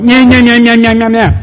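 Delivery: put-on loud, high childish taunt "Nyah, nyah"; mocking nyah nyah. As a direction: jeer like a bratty kid with fingers in ears. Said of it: "And we're all going".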